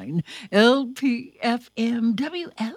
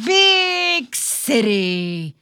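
slow title read; 7 dB louder, much slower than usual. A narrator's reading. Reading book title slowly.

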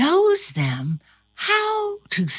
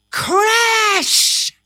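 crash shout; 14 semitones higher, 4 dB louder than usual. A narrator's reading. Crash sound effect.

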